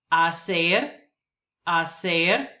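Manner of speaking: hacer as a-SER, silent h; hacer, rising at the end; Spanish word. Shown as a demonstration. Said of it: "words that I will pronounce".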